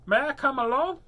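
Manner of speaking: high, loud mole voice; eager, high-pitched little mole; storybook mole. A narrator's reading. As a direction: pipe up as a mole asking along. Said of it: "Mole popped out".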